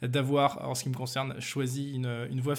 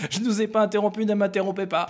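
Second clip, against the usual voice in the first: mock formal; 5 semitones higher than usual. Réplique de politesse théâtrale.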